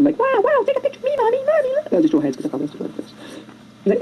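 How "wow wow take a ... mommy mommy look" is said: put-on very high squeaky child voice; high-pitched mock excitement; excited little people. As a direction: squeal like a thrilled kid calling mommy. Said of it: "little people are watching".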